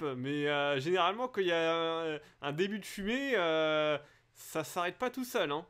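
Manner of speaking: usual speaking voice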